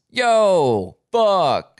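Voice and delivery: loud, high Jersey bro yell; Jersey accent. Retold or imitated. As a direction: belt it out like a Jersey guy. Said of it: "And then she says".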